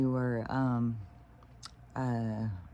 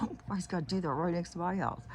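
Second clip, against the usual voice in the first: higher, quick, lightly voiced irritated neighbor; mock annoyed complaint; 7 semitones higher, faster than usual. Neighbor annoyed at the guy building next door, mock-peeved. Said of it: "She's like".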